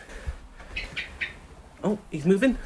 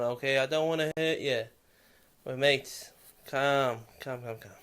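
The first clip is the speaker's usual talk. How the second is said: lower, softer, trailing calm calm calm; soothing a bird; 4 semitones lower, 7 dB quieter than usual. Real, not acted. To the hurt bird, soft fading soothing. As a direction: lull a frightened animal.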